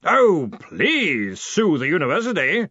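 slow, high, drawn-out relish; sarcastic bellow. A narrator's reading. Arch-Chancellor daring a man to sue, bellowing. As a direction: bellow it as a mocking invitation. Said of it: "Ridcully bellowed".

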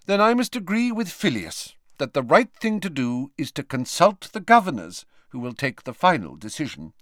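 stern Scottish teacher voice; brisk stern headmistress. Acted. McGonagall voiced in reading.

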